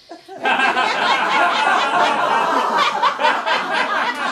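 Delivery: high, loud cackle; a loud, strained cackle. Acted. Laughing after let go let God.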